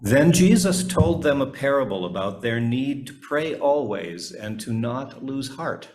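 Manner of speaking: ordinary delivery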